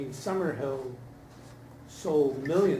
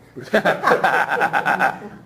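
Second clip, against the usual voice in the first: a loud burst of real laughter; big amused laugh; 12 dB louder than usual. Real, not acted. Reacting to the Return of Reich joke.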